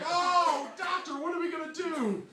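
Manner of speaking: high, distressed wail to a doctor; mock panic. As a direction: plead with the doctor in hammed-up alarm. Acts out worried patient.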